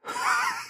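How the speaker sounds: squeaky high giggle. Real, not acted. Wife laughs after complaint.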